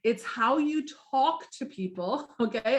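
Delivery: usual speaking voice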